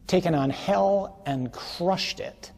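triumphant slow proclamation; fervent refrain. Real, not acted. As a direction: hammer hell and crushed it.

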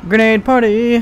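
loud, high party call; party cheer. Real, not acted. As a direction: shout it like a celebration.

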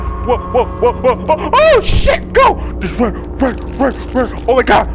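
high, loud alarmed cries; panicked yelling. Real, not acted. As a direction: yell in real fright. Monster chase panic.

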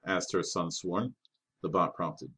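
ordinary delivery

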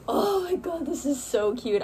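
high delighted squeal easing down; real excited gushing. Real, not acted. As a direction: gush over it, starting high. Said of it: "I'm so excited for it".

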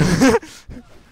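loud high surprised laugh; sudden high whooping laugh; burst of laughter. Real, not acted. Friend Kameto appears.

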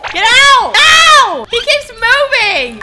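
high, loud yelling; playful exasperated shouting. Pretend. Player chasing a creep out of the house, playful shouting. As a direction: drive out an intruder, loudly.

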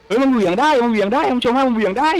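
high thrilled announcing to viewers; excited. Real, not acted. Streamer tells viewers the grapple swings, excited high.

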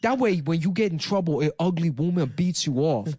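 mock foreign-accented politician; Andrew Yang. Presidential candidate announcing a giveaway, mock foreign accent. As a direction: announce policy in a put-on broken-English accent. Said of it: "using that classic Yang".

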